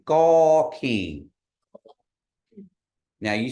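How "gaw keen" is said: Gaw keen loud, falling, nasal keen; model pronunciation. Shown as a demonstration. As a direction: say Gaw keen for them to copy. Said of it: "everybody repeat after me".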